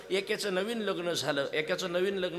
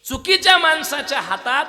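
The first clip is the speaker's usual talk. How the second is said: much higher, much louder sermon proclamation; full-force preaching; 9 semitones higher, 14 dB louder than usual. Acted. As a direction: proclaim it to the crowd. Preaching emphasis. (कीर्तनकाराचा जोशपूर्ण, मोठ्या आवाजातील उपदेश)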